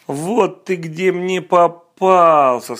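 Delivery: loud, slow, gloating hunter; triumphant hunter. Acted. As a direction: bellow it as the hunter. Hunter catches the wolf.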